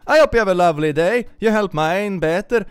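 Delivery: high, loud mock Irish accent. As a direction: belt out a cheery Irish accent. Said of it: "I probably nailed that accent".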